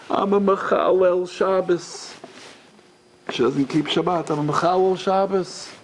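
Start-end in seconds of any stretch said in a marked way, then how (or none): 0.1-2.1 high, slow mock wail; lightly acted tearful confession; imitated crying. Sobbing student confessing to the rabbi, imitated high wail. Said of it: "in between her cries". 4.3-5.7 higher-pitched retold wail; a touch of tearful confession; imitated crying. Student confessing through tears, lightly imitated high wail. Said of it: "She's crying and crying".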